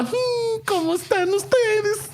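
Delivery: put-on high, slow old-lady voice in Spanish; old Mexican lady voice. Mocking the reviewer, a high old Mexican lady voice. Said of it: "him and I sound like old Mexican women".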